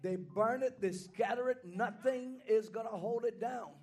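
preacher calls over the organ; preaching. Acted. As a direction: keep preaching with energy.

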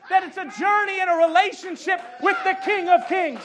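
shouted, high-pitched sermon climax; loud, ringing proclamation; full-force preaching. Real, not acted. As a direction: drive every phrase home to the congregation.

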